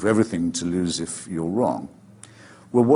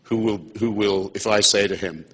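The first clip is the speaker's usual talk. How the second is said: ordinary talk, nothing marked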